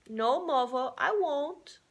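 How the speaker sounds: put-on a small boy promising firmly; child's firm promise. Charlie promising his mother, high and firm. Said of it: "He spoke with much decision".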